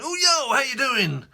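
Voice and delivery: very high, loud, bouncy yo; hammed-up cheery greeting. To the Amazon courier by mistake, a hammed-up cheery greeting. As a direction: overdo the friendly hello. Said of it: "because I'd gone".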